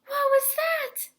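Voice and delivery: very high, loud alarmed ask; startled question. Acted. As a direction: gasp it out amazed. Startled by his reflection.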